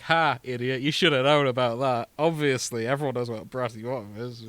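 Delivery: mock smug know-it-all. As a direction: play the smug friend who always knew. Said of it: "I'm gonna be like".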